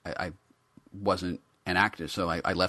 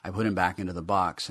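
ordinary delivery, nothing marked

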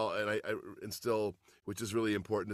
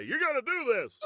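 mock order; 12 semitones higher than usual. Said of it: "you can't just say".

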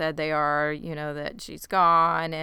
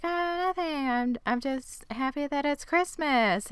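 a high, bright put-on innocence; fake cheerful girl; 7 semitones higher than usual. Girl lies to Martin about her night, chirpy. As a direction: play the girl hiding her secret behind cheer.

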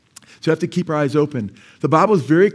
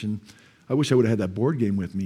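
ordinary delivery

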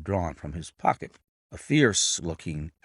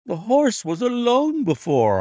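high, louder excited cry; cried out; 12 semitones higher, 5 dB louder than usual. A narrator's reading. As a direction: blurt it out in surprise. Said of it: "I cried".

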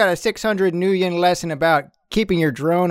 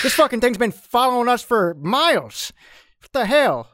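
annoyed thug; 7 semitones higher than usual. Acted. Dak angry at the drone.

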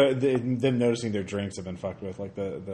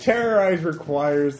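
ordinary talk

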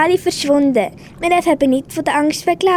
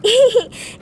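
short laugh; 6 semitones higher than usual. Acted. Relieved it was only seaweed.

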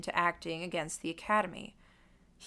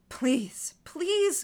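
begging, voice climbing higher; desperate pleading; 9 semitones higher, 4 dB louder than usual. Acted. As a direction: plead like someone pinned down. Gordon pinned down, begging.

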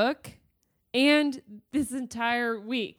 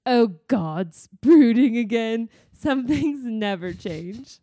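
louder teasing character voice, slowing; voiced book character; 7 dB louder, slower than usual. A narrator's reading. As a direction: read it as a loud, lightly voiced quote. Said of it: "A voice says".

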